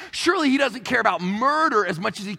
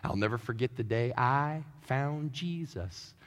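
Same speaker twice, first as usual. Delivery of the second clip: earnest testimony; 7 semitones lower, 8 dB quieter, slower than usual. Retold or imitated. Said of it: "I sometimes laugh to myself when people are like".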